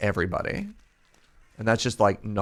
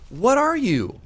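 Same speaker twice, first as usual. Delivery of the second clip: high, loud disbelieving question; high incredulous shout; 14 semitones higher, 6 dB louder, slower than usual. Pretend. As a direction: yelp it out in disbelief. Confronting monster again.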